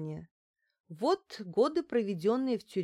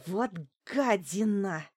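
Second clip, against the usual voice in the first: hateful whisper; slower than usual. Acted. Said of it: "с ненавистью прошептала Лариса".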